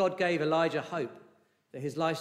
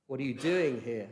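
DOING stressed, falling tune; 3 semitones lower than usual. Shown as a demonstration. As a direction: weigh down the word doing. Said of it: "where the emphasis you could put".

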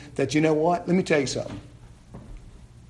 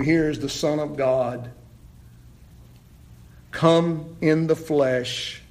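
ordinary talk, nothing marked

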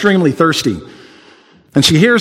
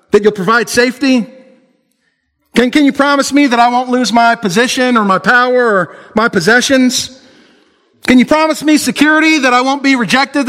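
much higher, pleading voice; imagined worried believer begging for promises; anxious believer; 8 semitones higher than usual. Acted. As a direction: bargain for safety, getting more desperate. Believer bargaining with Jesus.

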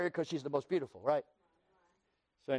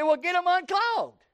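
pitched way up, comic demand; mock urgent demand; 11 semitones higher, 4 dB louder than usual. Patient telling the eye doctor to fix it, mock urgent demand. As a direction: push it high like an impatient patient.

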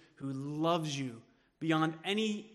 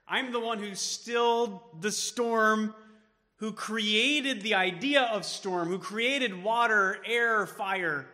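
declaring; 4 semitones higher, 6 dB louder, slower than usual. Pretend. Jesus declaring his power.

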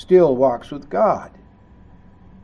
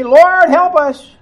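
mock desperate plea; 11 semitones higher, 10 dB louder, slower than usual. People crying out in trouble.

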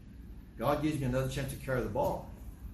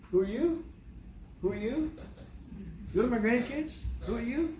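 mock amazement; 10 semitones higher than usual. Grandfather marveling at grandkids, high amazed questions. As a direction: gape at them as if they are strangers.

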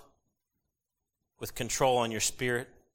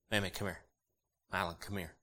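put-on softer, calm call to the kids; calm normal tone; 4 semitones lower, 8 dB quieter than usual. Shown as a demonstration. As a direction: call them quietly, no yelling. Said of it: "I'll talk to them in a normal tone of voice".

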